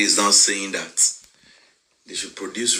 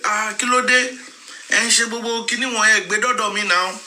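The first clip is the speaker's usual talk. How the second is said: high-pitched mock exclamation of protest; acted-out protest; 11 semitones higher than usual. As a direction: imitate someone protesting, pitched well up. Acting out Egbon's protesting reply.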